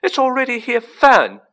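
loud high panic, bursting at the end; frightened, very high and loud; unnaturally high voice. A narrator's reading. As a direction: cry out shrilly in alarm, building to a yell. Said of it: "in an unnaturally high voice".

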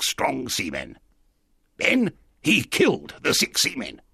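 odd castaway storytelling voice; strange castaway. Acted. Castaway telling the boy about buried treasure, strange voice. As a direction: spin the tale as an eccentric old shipmate.

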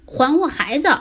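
high, demanding cry; big fish shouting for its child; loud shout. Acted. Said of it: "大声地喊道".

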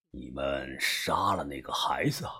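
put-on low, hushed older boss; old gang boss, troubled. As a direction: question gravely in a deep, soft voice. (把头低沉忧虑地追问)

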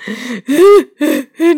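a rising sobbing wail; sob. A narrator's reading. Faun sobbing as Lucy praises him, rising sob. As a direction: sob aloud before speaking. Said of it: "托木纳斯边哭边说".

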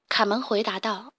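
plain reading voice

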